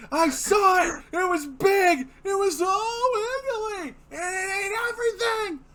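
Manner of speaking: high frantic screaming; panicked shouting. Acted. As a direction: scream it in terror.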